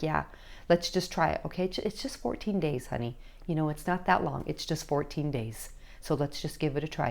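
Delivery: quiet, steady parental coaxing; calm reassurance. Acted. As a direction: reassure your kid it's a small thing. Parent calmly persuading a teen.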